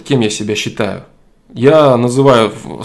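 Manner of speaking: usual speaking voice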